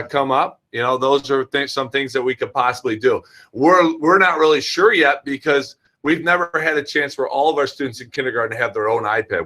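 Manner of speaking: ordinary talk, nothing marked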